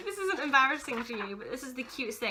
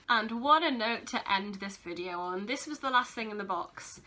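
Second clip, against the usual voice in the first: ordinary talk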